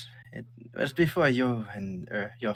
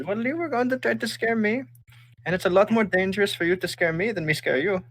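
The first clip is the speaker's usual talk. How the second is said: higher, gently teasing mom voice; a hint of an amused mother, pitched up; lightly voiced mother; 11 semitones higher, 4 dB louder than usual. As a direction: chide the kid playfully as her mother. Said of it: "She says".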